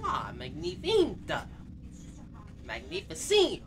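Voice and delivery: high, hammed-up French praise; drawn-out, showy French-style delight; mock French flourish. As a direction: kiss it off like a French chef. Praises sound like a chef.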